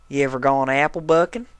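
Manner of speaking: lightly voiced coy country mare; flirting Applejack. A narrator's reading. Applejack flirting with Spike, lightly coy. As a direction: bat your lashes through the question. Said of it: "fluttering her eyelashes at him".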